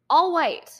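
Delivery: ALL said with alarm, a jolt of disbelief; shocked emphasis. Real, not acted.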